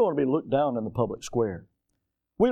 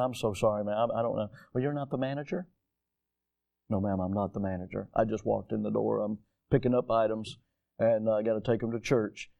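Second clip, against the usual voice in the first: soft, lower apologetic replies; mild polite murmur; 6 semitones lower, 6 dB quieter, faster than usual. Retold or imitated. Man in a suit telling an elderly shopper he is not the manager, softly. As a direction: answer a lady gently and softly. Retelling talk with elderly lady.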